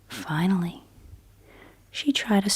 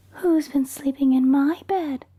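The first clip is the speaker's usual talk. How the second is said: higher, louder, voiced Mama Bear; medium-sized Mama Bear voice; 7 semitones higher, 4 dB louder than usual. Mama Bear asking after her porridge, in a higher, louder voice. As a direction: step out of the whisper into a motherly voice. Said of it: "cried Mama Bear in her medium-sized voice".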